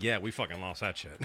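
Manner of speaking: lightly imitating a beaten fan, low and soft; mock Milwaukee fan. Imitating Milwaukee fans admitting the loss, low and soft. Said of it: "Milwaukee fans are like".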